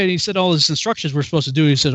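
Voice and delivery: ordinary talk, nothing marked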